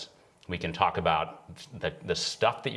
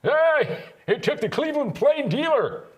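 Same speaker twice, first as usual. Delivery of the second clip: put-on much higher, louder crackly old man; 7 dB louder, slower than usual. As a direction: play a cranky old geezer. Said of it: "You should have said that in your old man voice".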